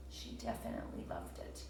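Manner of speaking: soft whispered aside. Real, not acted. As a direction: whisper it as a secret.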